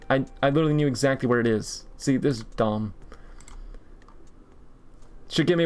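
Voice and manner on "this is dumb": ordinary talk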